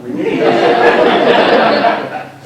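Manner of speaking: high, drawn out, half laughing; laughing plea. Retold or imitated. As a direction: plead through a laugh. Begging Derek, laughing.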